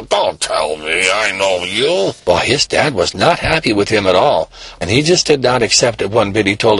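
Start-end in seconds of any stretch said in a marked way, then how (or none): 0.0-2.1 lower, slow, knowing father; stern dad. Acted. Father to his son who denies everything, slow and low. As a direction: drag it out like a dad who knows better.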